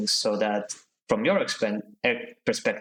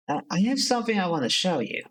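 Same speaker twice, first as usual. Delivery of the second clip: eager, hurried voice; 7 semitones higher, faster than usual. Acted. Bliss rushes after you.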